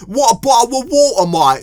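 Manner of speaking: high, loud mock British accent; hammed-up British "bottle of water" accent. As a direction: shout a caricature of British speech. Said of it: "people think British people sound like that".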